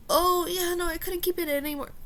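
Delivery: high-pitched mock friend's excuse; mocking imitation. Storyteller mimicking the friend who told her secret, mocking.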